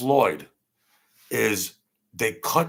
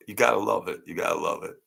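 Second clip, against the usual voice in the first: ordinary talk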